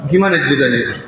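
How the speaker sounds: ordinary talk, nothing marked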